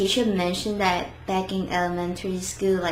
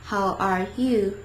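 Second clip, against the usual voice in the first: each word drawn out separately; careful beginner's pacing; slow, clear words; slower than usual. Shown as a demonstration. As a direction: say it slowly, word by word. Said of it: "They pronounce each word".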